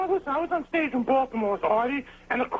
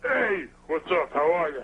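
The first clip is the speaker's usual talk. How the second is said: gruff voice again; 6 semitones lower than usual. Retold or imitated. Asked to repeat it, he redoes the gruff low voice slowly. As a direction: lower and slow, rough tough-guy greeting. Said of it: "Let me hear that voice again".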